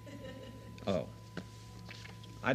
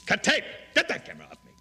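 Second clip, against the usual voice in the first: loud high shout to crew; shouted order; 11 semitones higher, 5 dB louder, much faster than usual. Acted. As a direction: bark the order loudly. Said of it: "two takes of the command".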